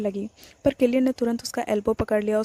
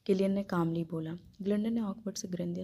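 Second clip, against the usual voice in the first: plain reading voice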